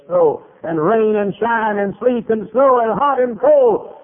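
loud, high, drawn-out list; preacher's rising fervour. Real, not acted. Preacher praising bus workers out in all weather, fervent and rising.

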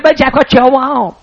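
high, loud farmer's reply; Wood's voice. Retold or imitated. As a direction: answer back like the old farmer from afar. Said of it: "he talked like this".

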